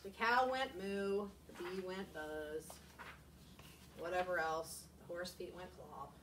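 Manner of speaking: dull, slow, no expression; flat boring reading. Shown as a demonstration. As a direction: read it like a bored robot.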